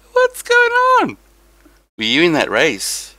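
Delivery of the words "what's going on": very high, loud falsetto; comic high-pitched holler; silly squeal. Pretend. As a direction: squeal the greeting in a piercing falsetto. Repeats own greeting in squeaky voice.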